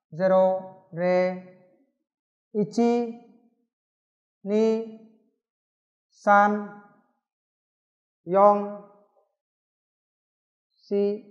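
each number said alone with long pauses; teacher reading numbers one by one; slow counting drill. Shown as a demonstration. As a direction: count aloud slowly for students to repeat. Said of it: "Bây giờ tôi sẽ đọc qua một lần".

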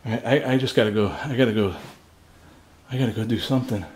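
ordinary delivery

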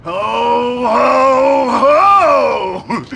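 belly-laugh it out like a mall Santa; jolly Santa laugh. Acted. Fake Santa laughing for photos.

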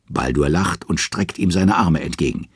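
usual speaking voice

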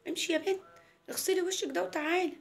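lightly voiced telling-off, sending the girl away; ordering grandma. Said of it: "عملت لها زي". (تيتا بتأمر البنت)

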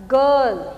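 "गर्ल" said loud with a long central "er" vowel; vowel demo. Shown as a demonstration. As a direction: stretch the "er" in "गर्ल" loudly for the class. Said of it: "जैसे आप बोलते हैं गर्ल".

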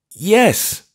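high, loud, meek apology; meekly, high-pitched old clergyman; meek old vicar. A narrator's reading. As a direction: shrink as you say sorry. Vicar answers eagerly.

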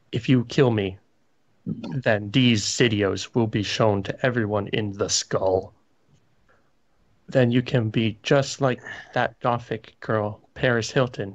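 usual speaking voice

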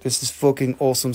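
usual speaking voice

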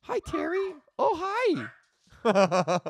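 high sing-song coo at the puppy. Real, not acted. Greeting the dog again.

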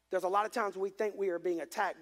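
ordinary talk, nothing marked

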